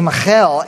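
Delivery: "Michal" with a throaty Hebrew kh; "Michal" in a put-on Hebrew accent. Shown as a demonstration. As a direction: say "Michal" as mi-KHAL, stressed at the end. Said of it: "say it with a Hebrew accent".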